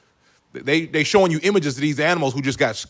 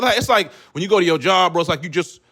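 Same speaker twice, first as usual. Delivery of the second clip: usual speaking voice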